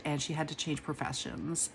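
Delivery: ordinary talk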